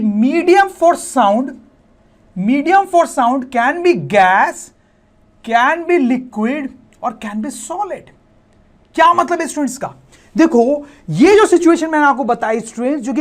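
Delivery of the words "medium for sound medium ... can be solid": each word drawn out slowly for copying; slow, spaced-out classroom dictation. Shown as a demonstration. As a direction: dictate it slowly, word by word, as you write on the board. Teacher dictating a definition aloud.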